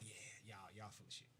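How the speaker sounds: low, very soft dismissive aside; quiet retold brush-off; dismissive mutter. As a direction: mutter it under your breath, unconvinced. Acting out his dismissive reaction.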